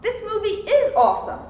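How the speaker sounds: bright excited exclamation. A narrator's reading. Excited thought in story.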